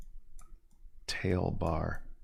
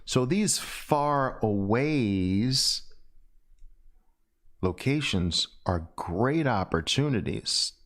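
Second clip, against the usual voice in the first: ordinary talk, nothing marked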